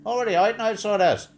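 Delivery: high, loud hammed-up Northern Irish; Northern Irish accent. Mimicking the phone woman for laughs, in Northern Irish.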